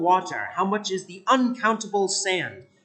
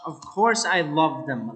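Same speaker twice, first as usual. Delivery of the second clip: usual speaking voice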